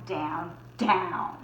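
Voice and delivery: usual speaking voice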